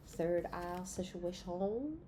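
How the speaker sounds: stretched slowly, word by word; slow mock-mystic drawl; slow drawn-out. Shown as a demonstration. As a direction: draw it out slowly. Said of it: "repeats phrase slowly".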